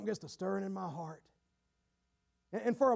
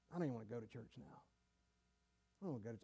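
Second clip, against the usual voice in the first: very low and soft, to himself; reluctant mumble; 13 semitones lower, 16 dB quieter, much faster than usual. Acted. Sinner dreading church after falling, reluctant mumble. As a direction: mumble it under your breath, dragging your heels.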